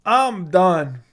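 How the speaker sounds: ordinary talk, nothing marked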